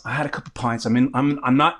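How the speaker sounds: ordinary delivery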